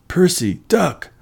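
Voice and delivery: high-pitched urgent warning; warning yell. A narrator's reading. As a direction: yell to a friend to get down, pitch jumping up. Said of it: "Tyson yelled".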